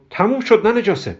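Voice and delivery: high-pitched brush-off; dismissive cut-in. Acted. Hamud cuts off Kobra's protest, high dismissive tone.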